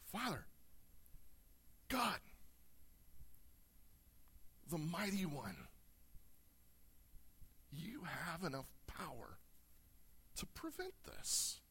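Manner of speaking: high, straining plea that breaks into breathy, near-silent words; a lightly voiced desperate prayer; anguished cry. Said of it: "he's declaring".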